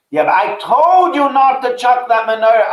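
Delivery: high, loud exasperated scolding; angry rebuke. Retold or imitated. Brother scolds sister for throwing away the heirloom, angry and loud.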